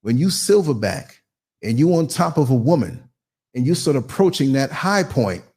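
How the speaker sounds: ordinary talk, nothing marked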